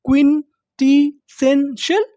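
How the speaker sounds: क्विंटिशेंशल stretched slowly with a lift at the end. Shown as a demonstration. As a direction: draw out क्विंटिशेंशल like a teacher. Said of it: "English में क्या बोलते हैं". (क्विंटिशेंशल को धीरे धीरे खींचकर बोलिए)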